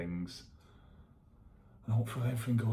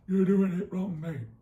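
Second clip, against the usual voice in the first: high, loud jeering heckler; mocking critic voice; 11 semitones higher, 9 dB louder than usual. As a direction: shout it like a smug know-it-all. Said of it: "Before someone says".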